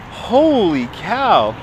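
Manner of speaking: usual speaking voice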